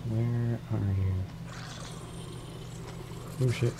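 ordinary talk

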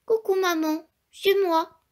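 high, bright little chick voice; tiny chick greeting mom; baby chick. A narrator's reading. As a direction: chirp it like a newborn chick. Newborn chick greets mother.

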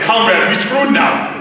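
badly faked Russian accent; terrible Russian accent. Joking as a Russian hacker to the audience, terrible Russian accent. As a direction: put on a hammy Russian accent. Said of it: "That's my terrible, terrible Russian accent".

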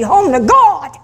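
high, ringing proclamation; rousing climax. Real, not acted. Preacher ending on life's journey, rousing and high. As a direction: lift it high to the congregation.

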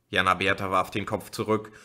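ordinary delivery

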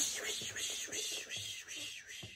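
soft breathy wiper swishes; whispered swish. Shown as a demonstration. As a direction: hush it like windshield wipers. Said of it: "they go swish".

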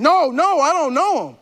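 loud, high-pitched protest; agitated denial. Acted. Peter to his accusers by the fire, agitated denial. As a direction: deny it loudly, getting worked up. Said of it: "And now he starts getting".

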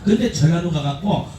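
ordinary talk, nothing marked

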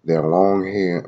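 ordinary talk

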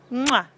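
short loud smack; quick kiss to copy; punchy mwah; kiss sound. Shown as a demonstration. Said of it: "Can you blow kisses".

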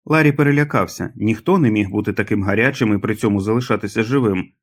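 ordinary delivery, nothing marked

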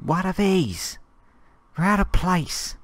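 high, puzzled squeak of disbelief; baffled surprise. Real, not acted. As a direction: act truly thrown by something out of place. Baffled by odd trees.

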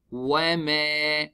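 وما with the alif held two counts; stretched alif. Shown as a demonstration. Teacher showing the stretched alif in وما, slowly. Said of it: "We stretch it حركتين".

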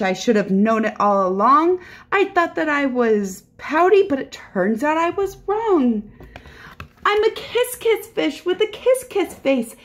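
high, bouncy storybook fish; sing-song, delighted change of heart; happy kissing fish. Acted. Voices the newly happy fish.

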